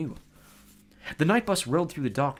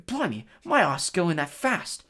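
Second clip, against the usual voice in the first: shaken conductor; 5 semitones higher, 3 dB louder, slower than usual. Acted. Stan shaken, clutching chest.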